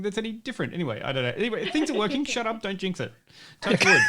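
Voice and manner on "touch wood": very high squeaky touch wood. Pretend. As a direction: squeak it out in falsetto. Jokey superstition.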